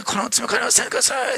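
soft, tender dying prayer; joyful prayer. Retold or imitated. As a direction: forgive them with quiet joy. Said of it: "本当に喜びながらだと思うんです".

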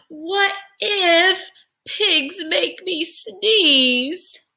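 high, very slow worried voice; fret, drawn out and high. Acted. Elephant worried, character voice.

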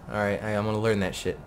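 ordinary delivery

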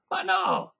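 high cry of dismay; aghast, high outburst; dismayed yell. A narrator's reading. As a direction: exclaim in appalled protest. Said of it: "urlò Paganini sgomento".

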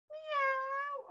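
high, soft mock meow; high, soft cat meow for the puppet. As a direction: mew like a little kitten.

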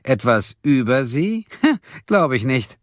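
teasing scorn with a laugh; mock him with a high laugh; scornful. A narrator's reading. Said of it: "sagte Silje höhnisch".

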